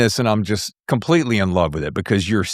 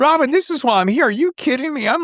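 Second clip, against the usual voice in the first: high, eager and urging; real excitement, pitched way up. Real, not acted. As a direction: beg him to keep going, thrilled. Host eager for the story.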